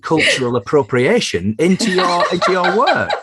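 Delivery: high, giggly teasing through laughter; laughing tease. Real, not acted. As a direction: tease her while laughing. Host teasing guest, laughing.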